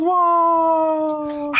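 high drawn-out crowd whoa; a whole crowd going whoa; crowd cheering. Acted. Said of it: "And the crowd goes".

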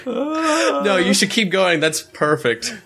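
squeaky high mock protest; high pitched. Real, not acted. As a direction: pitch way up in fake delight.

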